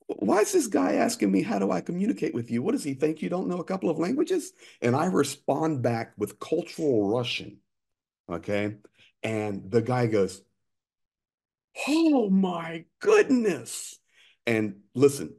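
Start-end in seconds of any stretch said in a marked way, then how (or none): 0.1-4.5 much higher, fast mock exasperation; indignant retelling. Partner complaining to Greg about the checkpoint guard, high and exasperated. 11.9-14.0 much higher, slow, drawn-out astonishment; amazed. Retold or imitated. Checkpoint guard hearing the American speak Russian, stunned and slow.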